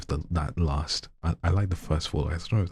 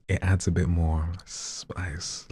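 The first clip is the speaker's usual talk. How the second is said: ordinary talk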